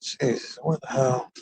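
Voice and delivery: whispered annoyed mutter to himself; under-breath mutter. Real, not acted. Muttering at traffic while driving.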